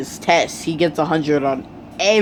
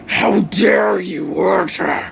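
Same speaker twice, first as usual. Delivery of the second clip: demon mode. Acted. Hero enraged at the villain who hurt Uraraka, demonic fury. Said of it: "He goes full on demon mode".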